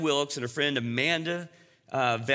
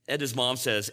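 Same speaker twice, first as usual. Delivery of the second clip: usual speaking voice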